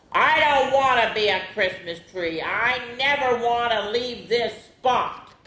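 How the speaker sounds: a lightly voiced stubborn sapling; grumpy tree refusing to leave; mumbling. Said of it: "the little tree mumbled".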